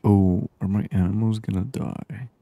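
usual speaking voice

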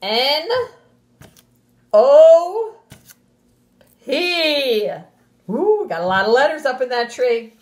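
lively, sing-song storybook voice, well above her normal pitch; animated read-aloud to a class; reading to children. Acted. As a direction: read it big and bouncy for little kids. Teacher reads picture book aloud.

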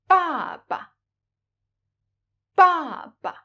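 "Ba ba" with a falling first syllable and a short, light, toneless second; father, "Ba ba" with light second syllable; neutral tone baba. Shown as a demonstration. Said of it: "the second ba is pronounced very short and light".

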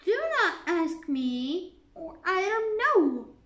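impolite. A narrator's reading. Alice answers the Queen not very politely. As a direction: snap back rudely at the Queen. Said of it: "not very politely".

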